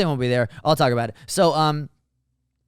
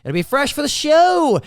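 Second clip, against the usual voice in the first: excited hype; 10 semitones higher, 3 dB louder, slower than usual. Real, not acted. Hyping a new bit.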